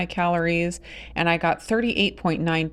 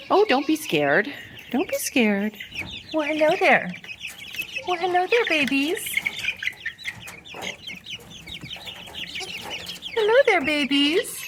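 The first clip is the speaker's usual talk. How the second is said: much higher, gentle cooing voice; 11 semitones higher than usual. Real, not acted. Soothing scared chicks.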